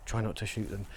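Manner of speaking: kept low and soft so the crew won't hear; hushed. Real, not acted. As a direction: keep your voice down near the crew. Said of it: "I'll be really quiet".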